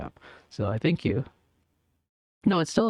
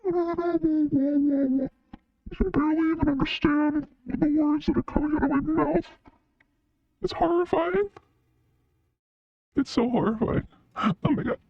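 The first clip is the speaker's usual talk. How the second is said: high pitched voice changer. Shown as a demonstration.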